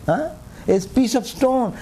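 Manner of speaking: normal talking voice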